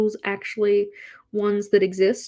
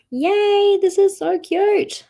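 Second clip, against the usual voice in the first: high, louder delighted squeal; excited; 10 semitones higher, 5 dB louder, much slower than usual. Real, not acted. Delighted at finished page.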